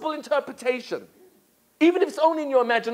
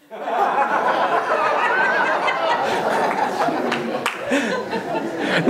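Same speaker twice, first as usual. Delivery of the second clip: real breathy laughter; long laugh; 3 semitones higher than usual. Real, not acted. Preacher laughs at his own joke, real breathy laughter. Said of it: "I'm laughing".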